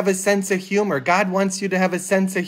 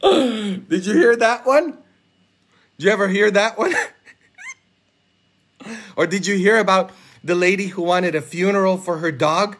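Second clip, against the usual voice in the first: ordinary delivery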